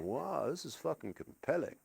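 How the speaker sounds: very soft, low murmur; hushed awe, retold. Comedian retelling being hooked on bird videos, hushed wonder. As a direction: say it under your breath, mildly entranced.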